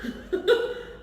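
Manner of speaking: short airy giggle; a quick laugh out of breath; light breathy laugh. Real, not acted. Laughs while buttering bread.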